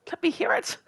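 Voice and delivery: high-pitched mock begging; playful pleading.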